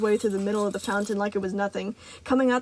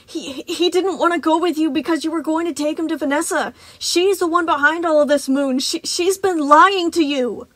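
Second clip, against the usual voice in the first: desperate plea; 6 semitones higher, 6 dB louder than usual. Acted. Freddy pleading, stammering.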